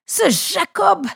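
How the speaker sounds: exclamation aiguë et traînante, désespérée; lâche le nom avec désespoir, lentement. A narrator's reading. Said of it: "s'écria-t-il désespéré". (cri de frère trahi, haut et lent)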